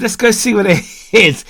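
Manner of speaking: high, loud, laughing through the words; laughing dare. Retold or imitated. Telling Rich to go see the boar, laughing. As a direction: egg him on while cracking up. Daring friend to chase boar.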